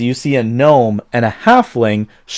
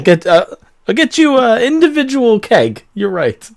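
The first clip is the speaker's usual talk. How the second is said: flustered squeak; 10 semitones higher than usual. Acted. Halfling barkeep flustered.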